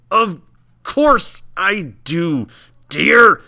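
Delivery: high, slow, sputtered words like steam; sputtering hiss. Acted. Old woman answering the boy, sputtering hiss. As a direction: hiss it out through the pain. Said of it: "she sputtered and ... hissed".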